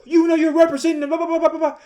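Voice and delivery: high, fast mocking rant. Stranger going off on wife in grocery store, high mocking rant. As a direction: rattle it off in a high mock tirade. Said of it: "he just started going off on her like".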